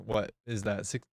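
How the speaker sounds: ordinary talk, nothing marked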